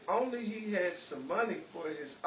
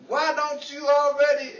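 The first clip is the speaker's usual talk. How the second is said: heckler's yell; 7 semitones higher, 9 dB louder than usual. Retold or imitated. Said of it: "someone yelled".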